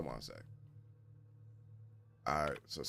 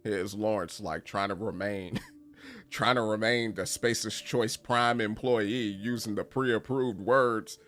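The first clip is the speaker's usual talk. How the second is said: normal talking voice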